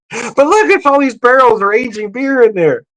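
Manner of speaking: high, enthusiastic beer-geek wonder; excited insistence. Retold or imitated. Husband pointing out aging barrels to his wife, excited.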